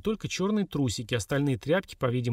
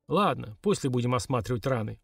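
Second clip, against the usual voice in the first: ordinary delivery, nothing marked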